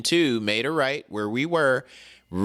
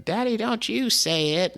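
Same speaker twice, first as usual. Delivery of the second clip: put-on higher child's voice, scolding; imitating his kid; 11 semitones higher than usual. Dad imitates his kids scolding him about slang, higher mock child voice.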